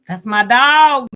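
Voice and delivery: loud, drawn-out proud holler; big stretched-out shout of affection; hollered catchphrase. Retold or imitated. You know when they say.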